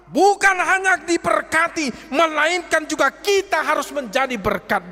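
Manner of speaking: high, loud impassioned preaching; preaching at full force. Acted. Preacher urging the congregation to repay kindness, impassioned high shout. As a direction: cry it out to the congregation.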